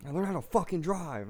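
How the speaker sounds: indignant jab, not full volume; retold yelling. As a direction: re-enact a heckle at a departing driver. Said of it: "I was yelling at him".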